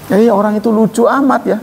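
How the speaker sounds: mild mocking voice; mock ridicule. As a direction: laugh at someone in a sneering tone. Voicing someone mocking a person.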